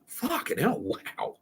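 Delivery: high then breathless stunned curse; mock-awed disbelief. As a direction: fade into an awed hush. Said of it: "I'm like".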